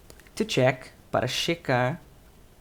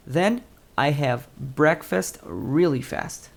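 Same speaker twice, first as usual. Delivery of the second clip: slow model reading; much slower than usual. Shown as a demonstration. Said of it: "frase modelo lida devagar".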